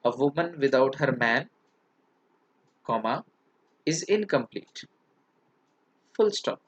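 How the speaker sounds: "man" rising, then a break before "is incomplete"; pause at the comma. Shown as a demonstration. Teacher reads out a boy's punctuation, pausing at the comma. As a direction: hold "A woman without her man" together, pause, then "is incomplete". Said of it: "उसने ऐसे लगाया".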